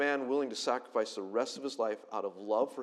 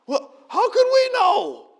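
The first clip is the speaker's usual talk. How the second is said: very high, slow, whiny mock protest; mock clueless brothers; 14 dB louder, slower than usual. Preacher mocking the clueless brothers, very high and slow. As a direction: play the brothers as dim and whiny.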